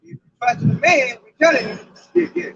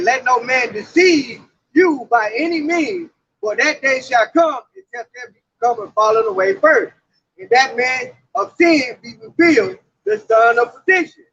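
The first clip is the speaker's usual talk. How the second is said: scripture reading; 4 semitones lower than usual. Acted. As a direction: declaim it from the pulpit. Scripture read in preaching cadence.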